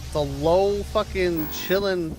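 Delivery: usual speaking voice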